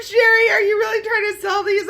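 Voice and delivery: high-pitched laughing shout; laughing plea. Retold or imitated. Laughing loud at Sherry's crafts.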